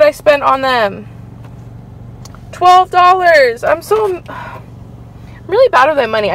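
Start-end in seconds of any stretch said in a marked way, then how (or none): 2.5-3.6 high, loud, drawn-out outrage; aghast at the price; appalled disbelief. Real, not acted. Revealing shocking price of chips.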